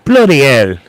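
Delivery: light Cockney exclamation; Cockney accent. Shown as a demonstration. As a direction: say it as a mock Cockney. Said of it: "uh, Cockney".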